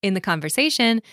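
ordinary talk, nothing marked

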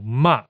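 MA falling sharply; "ma" with a sharp downward fall; fourth tone. Shown as a demonstration. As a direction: say "ma" emphatically, pitch dropping. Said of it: "the tone is falling and it falls".

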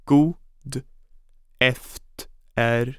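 broken into syllables. Shown as a demonstration. Teacher splitting the phrase for learners, slow and syllable by syllable. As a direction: pause after "God", then stretch "eftermiddag" slowly. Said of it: "Let's break it down by syllable".

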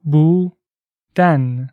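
slow, drawn-out بودن with each sound clear; slow and careful. Shown as a demonstration. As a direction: stretch out بودن slowly, syllable by syllable. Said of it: "listen and repeat".

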